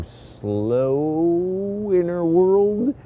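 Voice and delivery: very slow, stretched words; drawn-out slow. Shown as a demonstration. Mimicking the slow-talking lady, very slow. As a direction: drag each word out slowly. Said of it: "doesn't have to be so".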